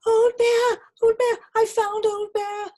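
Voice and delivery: high, loud, jumping-for-joy call; little bear's high excited shout. A narrator's reading. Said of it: "he shouted".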